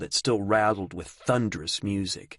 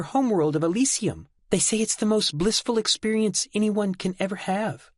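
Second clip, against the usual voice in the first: put-on high, light girl voice; young woman voice; 12 semitones higher than usual. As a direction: recite it like a devout girl. Delphi recites doctrine.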